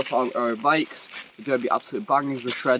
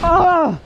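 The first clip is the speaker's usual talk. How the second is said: high, loud startled cry; startled yell; 5 dB louder than usual. Real, not acted. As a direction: yelp at a sudden slip. Sudden scare while riding.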